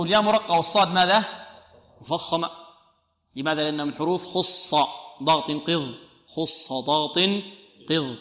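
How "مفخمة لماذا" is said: normal talking voice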